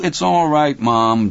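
plain reading voice

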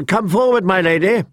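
high, bright-smiled summons; smiling call. A narrator's reading. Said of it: "he called out, smiling".

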